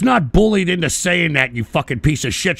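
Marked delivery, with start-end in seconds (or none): none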